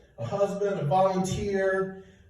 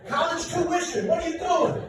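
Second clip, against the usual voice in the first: higher, mock-outraged call; mock shout; 7 semitones higher than usual. Dad calls out to a sneaker wearer, mock-outraged. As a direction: call out the son, hammed-up. Scolding the son's shoes.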